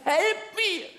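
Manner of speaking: high-pitched drawn-out mock cry for help; calling for help. Castaway calling again as the boat comes closer, high mock cry. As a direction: wail it out like a comic castaway. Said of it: "hat er wieder gerufen".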